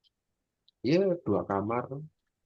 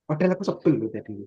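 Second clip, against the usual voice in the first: exasperated; 8 semitones higher, 5 dB louder, faster than usual. Acted. Mock exasperation at chatting friend.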